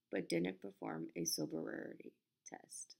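normal talking voice

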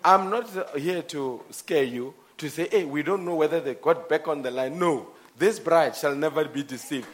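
emphatic sermon delivery; forceful preaching. Real, not acted. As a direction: drive the assurance home loudly. Preaching with raised voice.

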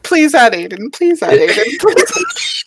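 whiny pleading, rising high. Acted. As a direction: beg over and over. Begging text, mock pleading.

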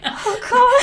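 high laughing exasperation; laughing groan. Retold or imitated.

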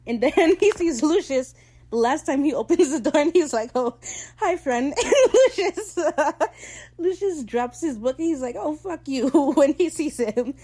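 high, loud, laughing through the retelling. Real, not acted. Recapping Lucius at the opened door, laughing hard. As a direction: crack up while telling it.

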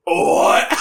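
loud, put-on bloke's greeting; mock man's voice. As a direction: imitate a co-host gone manly. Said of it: "And you go, "All right".

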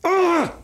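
high, loud strained groan. Acted. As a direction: let out a high, held groan. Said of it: "you hear him kinda go".

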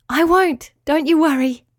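cheerful little spider reassuring; smiling. A narrator's reading. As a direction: promise it with a smile. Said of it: "smiled Winky".

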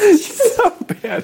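high laughing protest. Real, not acted. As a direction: laugh while defending it. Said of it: "Why is this so funny".

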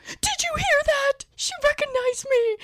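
thrilled little sister showing off to her brother, squealing; giddy squeal. Retold or imitated. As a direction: squeal it like a starstruck kid. Bragging to brother in elevator.

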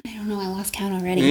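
breathy whispered aside; whispering. Real, not acted. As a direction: whisper it like a confession. Whispered aside after counting.

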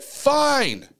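a retold high, loud reluctant fine; exasperated giving in. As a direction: throw up your hands and give in. Gives in while crying, retold.

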